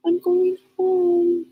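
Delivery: put-on high, slow little girl voice; little girl's voice. Little girl telling the narrator she's going home, high and slow. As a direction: voice a small girl proudly saying she's leaving. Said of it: "She was going home".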